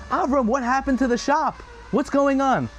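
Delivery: high, urgent demanding voice; alarmed father. Acted. Father confronting his son about the wrecked shop, alarmed. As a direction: demand answers, shaken.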